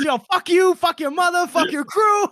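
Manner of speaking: high, loud mock street insult; hammed-up trash talk. Host retelling Baez's insult as loud mock trash talk. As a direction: yell it like a playground taunt. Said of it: "Baez says".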